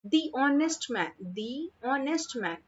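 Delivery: "The" as dee, gliding into "honest"; slow "The honest man", dee and silent h. Shown as a demonstration. Teacher showing the before vowel sound, "The honest" as दी ऑनेस्ट. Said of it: "अ की sound आ रही है".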